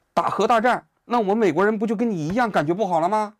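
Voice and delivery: high, loud, rushed mock outburst; agitated Trump rant. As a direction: let it spill out heated and loud. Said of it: "因为川普这段一着急的时候啊". (模仿川普着急地高声嚷嚷)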